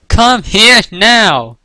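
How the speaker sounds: slow, high, loud shout; calling out. A narrator's reading. Rarity calls the playing fillies, shouting. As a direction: yell each word after runaways. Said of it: "Rarity called".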